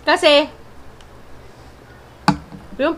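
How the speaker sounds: talking while chewing rice; speaking between bites; mouth full of rice. Real, not acted. Seen 1: Left speaker spoons rice into mouth at 0.4, chews until ~2.9.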